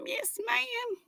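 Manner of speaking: "ma'am" very high and soft, falling; squeaky high yes ma'am. Pretend. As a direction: pipe it up like a sweet little girl. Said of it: "repeats quote up high".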